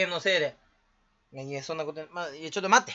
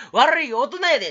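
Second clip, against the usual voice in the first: louder, comic accusing quote; mock scolding; 7 semitones higher, 7 dB louder than usual. Streamer quips about padding time, mock scolding. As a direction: call it out with hammed-up reproach.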